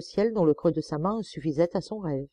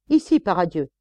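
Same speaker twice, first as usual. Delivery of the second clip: loud barked summons; calling out; 8 dB louder than usual. Acted. Sergeant calling the prisoner from the doorway, loud. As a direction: call him out of his cell sharply.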